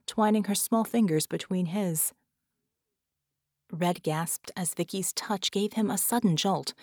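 usual speaking voice

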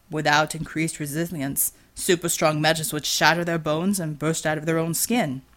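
low, measured voice of a lecturing teammate; a hint of a calm, deep-voiced teammate; lightly voiced explainer. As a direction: explain it like a dry expert. Matai explains, voiced lower.

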